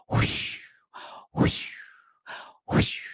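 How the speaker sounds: soft breathy whoosh noises; whispered boat whoosh. Acted. Boat speeding off, soft whooshing sound.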